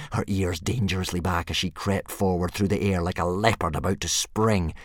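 ordinary delivery, nothing marked

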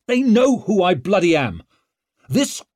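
high, loud, impatient shout; angry interruption. A narrator's reading. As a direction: cut the announcer off furiously. Leo angrily interrupts the announcer.

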